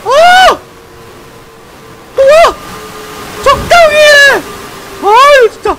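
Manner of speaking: high startled shrieks; startled screams. Real, not acted. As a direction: scream in sudden fright. Startled by a game ambush.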